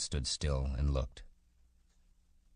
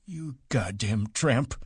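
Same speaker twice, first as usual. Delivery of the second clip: slow, higher, spat-out contempt; vicious; 8 semitones higher, slower than usual. A narrator's reading. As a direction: drag out the insult with venom. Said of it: "he said viciously".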